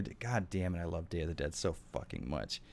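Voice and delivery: usual speaking voice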